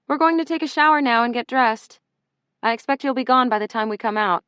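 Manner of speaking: called out loudly over the shoulder; calling out. Acted. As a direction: raise the voice to carry to another room. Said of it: "she called over her shoulder".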